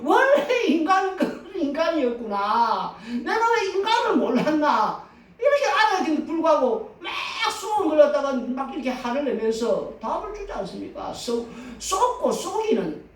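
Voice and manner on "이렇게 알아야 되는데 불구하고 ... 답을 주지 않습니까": ordinary talk, nothing marked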